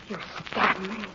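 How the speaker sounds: low, faint voice; dying gasp. Acted. Millie dying at Henry's hand, faint. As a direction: barely get it out, fading. Dying wife's last words.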